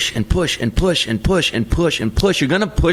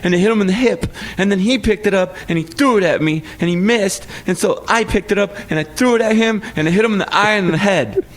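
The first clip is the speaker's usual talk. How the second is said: put-on high, fast child's account; daughter's story; 7 semitones higher than usual. Dad imitates his daughter describing the fight, high and fast. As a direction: rattle it off like a kid. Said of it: "And she said".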